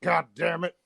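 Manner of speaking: ordinary talk, nothing marked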